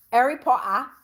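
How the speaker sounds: a quick attempt at a British accent; British accent try. Shown as a demonstration. Trying a British accent for Nadine from the UK. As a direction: toss off "Harry Potter" in a British accent. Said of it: "Let me rock it out, Nadine".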